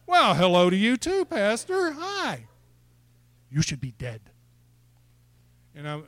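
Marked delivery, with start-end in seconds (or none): 0.1-2.5 high-pitched, awkwardly bright comeback; reply in exaggerated high-voiced politeness; mock taken-aback greeting. Preacher answering the pastor's blunt words, high mock-polite surprise. 3.5-4.2 put-on low-pitched Nigerian-accented pronouncement. Pastor Emmanuel repeating his word to the preacher, Nigerian accent. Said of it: "he has that, that wonderful accent".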